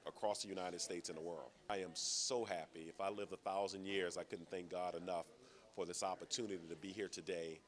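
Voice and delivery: ordinary talk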